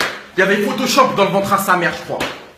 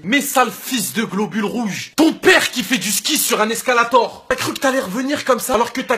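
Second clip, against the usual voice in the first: normal talking voice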